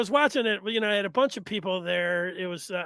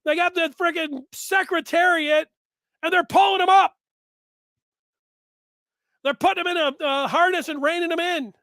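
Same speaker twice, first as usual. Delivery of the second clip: higher, fast mock fan rant; 1 fan complaining; 7 semitones higher, 4 dB louder than usual. Fan griping to the host that Michigan is too predictable, high mock rant. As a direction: whine like a fed-up fan about wasted talent. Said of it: "you're gonna say".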